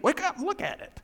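lightly voiced high call to wake; Paul calling out. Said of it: "He's kinda saying".

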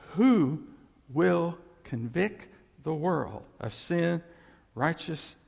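loud on Who, then slow; pointed rhetorical question. Acted. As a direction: make them wait on each word.

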